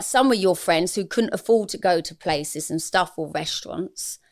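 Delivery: normal talking voice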